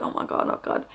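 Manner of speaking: soft, near-whispered retold worry; hushed dismay. Mother when nothing had happened, hushed mock dismay. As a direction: say it under the breath, imitating worry. Retold private panic during pushing.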